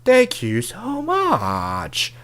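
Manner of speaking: high, drawn-out squeal of gratitude; mock gushing. Woman reacting to a love confession, mock gushing. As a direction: squeal it slowly, hamming up the delight.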